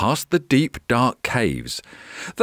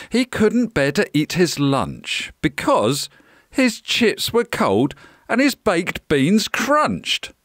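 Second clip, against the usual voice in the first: plain reading voice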